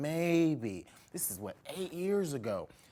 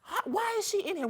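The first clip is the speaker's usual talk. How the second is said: high, startled asking; surprised. Retold or imitated. Retelling spotting the pastor in church, surprised. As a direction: gasp it out, can't believe it. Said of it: "I was like".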